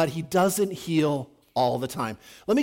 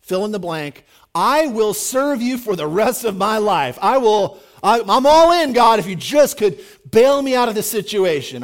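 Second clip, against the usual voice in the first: louder, rising desperate promise to God; pleading bargain; 3 semitones higher, 7 dB louder than usual. Retold or imitated. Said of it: "How many of you guys would be like".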